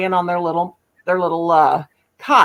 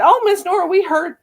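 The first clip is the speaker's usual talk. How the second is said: high excited parents; 10 semitones higher than usual. Retold or imitated. Parents quoted in story.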